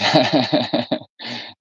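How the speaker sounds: drawn-out giggling laugh. Real, not acted. Talking about a secretive man, a laugh. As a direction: break into a run of chuckles.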